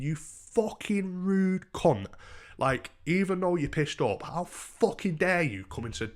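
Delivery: high-pitched indignation without raising volume; indignant outrage. Retold or imitated. Thinking at the woman shouting downstairs, indignant. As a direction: drag out the insult, scandalised.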